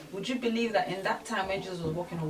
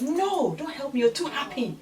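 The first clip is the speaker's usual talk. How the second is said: rude refusal; 7 semitones higher, 5 dB louder than usual. Retold or imitated. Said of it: "she was very bold to say".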